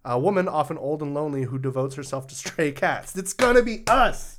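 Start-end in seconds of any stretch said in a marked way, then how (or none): 3.2-4.4 mock dismay. Joke about becoming cat ladies.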